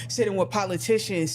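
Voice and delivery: normal talking voice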